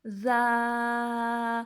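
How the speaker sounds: زا held three beats; stretched za. Shown as a demonstration. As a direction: draw out زا for three counts. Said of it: "اس کو بھی تین الف لمبا کریں گے".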